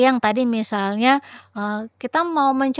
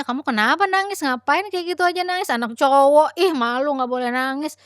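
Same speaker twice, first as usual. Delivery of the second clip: put-on high, scolding parent telling a boy off; 6 semitones higher than usual. Shown as a demonstration. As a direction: scold him for crying, pitched up. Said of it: "Ini mencontohkan ya". (orang tua yang memarahi anak karena menangis, nada tinggi)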